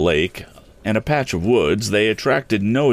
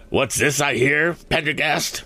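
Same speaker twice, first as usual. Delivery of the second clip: lightly voiced pompous banker, higher; hearty exclamation; 8 semitones higher than usual. A narrator's reading. Foger calls out to Pendergast, lightly voiced and hearty. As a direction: call out in mock delight. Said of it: "he cried".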